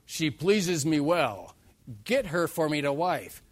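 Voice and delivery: loud, slow, insistent declaration; slow loud demand; demanding son. Retold or imitated. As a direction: Samson demanding his bride. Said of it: "A son that says".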